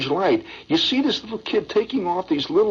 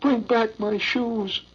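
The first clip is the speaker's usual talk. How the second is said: put-on higher, slow, begging child's voice; pleading little boy; 6 semitones higher, slower than usual. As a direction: beg like a lost little boy in the dark. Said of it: "this little kid said".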